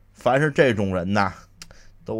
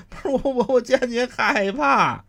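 stammering in fear; 12 semitones higher, 4 dB louder than usual. Acted. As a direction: trip over the words, terrified.

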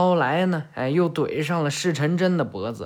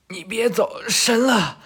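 calling after him, rising higher and louder; desperate shout; 6 semitones higher, 3 dB louder than usual. Acted. Boruto crying out as Kagura walks away, rising desperate yell. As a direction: yell for him to stop, building on the name. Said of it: "博人喊道".

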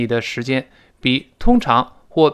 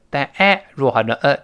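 ordinary talk, nothing marked

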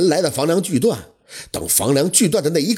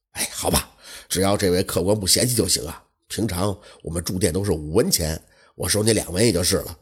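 lower, softer innkeeper turning a guest away; apologetic innkeeper; 7 semitones lower, 4 dB quieter than usual. Acted. Innkeeper apologizing to the guest, lower and softer. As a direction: innkeeper sorry, but no rooms tonight.

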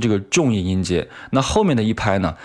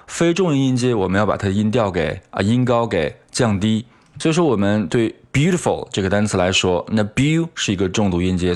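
ordinary talk

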